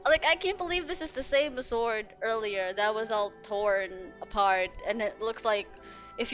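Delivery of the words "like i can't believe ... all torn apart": ordinary talk, nothing marked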